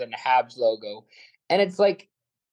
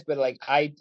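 normal talking voice

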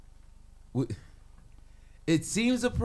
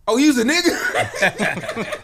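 slow, higher, laughing realization; mock surprised discovery; 4 semitones higher, 5 dB louder, much slower than usual. Girls seeing under the bird's head, surprised. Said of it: "you pull off the head like".

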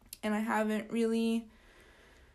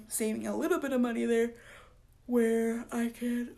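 yawning. Real, not acted. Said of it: "I'm yawning on you guys".

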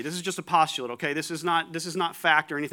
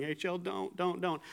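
much softer, held-in "Hey, Chell, don't"; wife's silent, softer warning in her head; inner plea; 3 semitones higher, 7 dB quieter than usual. Retold or imitated. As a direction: say it as if only thinking it. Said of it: "in her head, she's saying".

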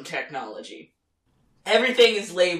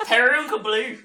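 higher, louder Irish accent through laughter; attempted Irish accent; 6 semitones higher, 6 dB louder than usual. Shown as a demonstration. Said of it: "if you do your Irish accent".

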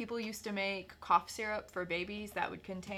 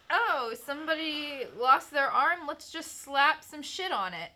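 high, loud careless doctor voice; mocking ancient doctors; 6 semitones higher, 5 dB louder than usual. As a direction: toss it off like a clueless healer. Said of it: "they were like".

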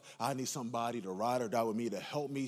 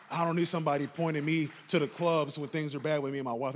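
louder, faster preacher's run; preaching at full force; 4 semitones higher, 5 dB louder than usual. Acted. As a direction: preach it with rising urgency. Preaching to the congregation.

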